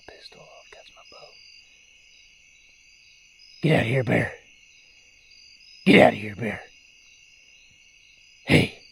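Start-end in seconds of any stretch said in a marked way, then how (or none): 3.6-6.6 loud yell at a bear; scaring off a bear. Real, not acted. 8.4-8.8 sharp loud shout; scaring off a bear. Real, not acted.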